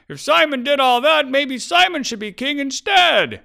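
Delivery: high, loud rallying cry; voice from the crowd. Acted. Magical voice planted in the crowd to sway villagers, high shout. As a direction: shout it out to stir up a whole crowd. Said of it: "a voice magically came from the center of the crowd".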